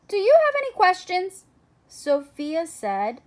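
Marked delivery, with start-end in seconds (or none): 0.1-1.4 brisk clerk. Acted. Clerk rattles off a question.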